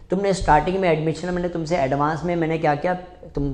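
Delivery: ordinary talk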